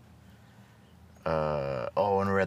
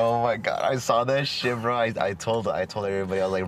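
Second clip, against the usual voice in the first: usual speaking voice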